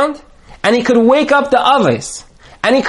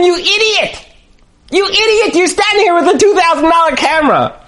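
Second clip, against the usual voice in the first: pitched-up shouted disbelief; exasperated rant; 5 semitones higher than usual. Retold or imitated. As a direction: berate a friend who wastes a great camera. Friend berates camera owner, exasperated.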